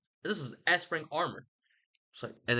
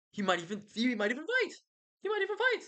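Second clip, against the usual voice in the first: rising hype; 10 semitones higher than usual. Retold or imitated. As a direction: pitch climbs higher on each repeat until it squeaks. Hyping Achilles' return.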